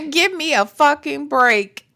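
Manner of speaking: usual speaking voice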